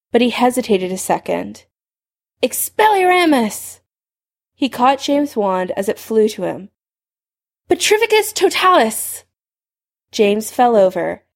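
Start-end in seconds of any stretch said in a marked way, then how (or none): 2.4-3.8 high, drawn-out incantation; called-out spell. A narrator's reading. Spell cast in a duel. 7.7-9.2 high-pitched incantation; sharp rise into a cast spell; called-out spell. A narrator's reading.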